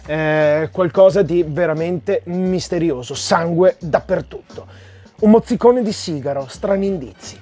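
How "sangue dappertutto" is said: ordinary delivery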